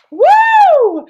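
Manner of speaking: loud, high whoop of joy; cheering whoop. Real, not acted. Coach whooping for her viewers, joyful cheer. Cheering right after excitement.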